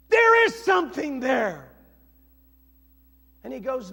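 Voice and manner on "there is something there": loud shout falling at the end; big pulpit pronouncement; emphatic declaration. Real, not acted. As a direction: declare it forcefully. Triumphant answer shouted to congregation.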